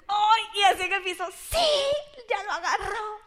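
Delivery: excited squeal. Retold or imitated. Reenacting herself on the floor.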